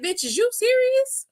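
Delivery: high-pitched mock disbelief; incredulous. Imitating a wrestler answering his accuser, incredulous.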